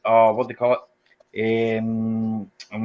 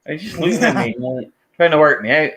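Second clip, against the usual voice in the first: ordinary delivery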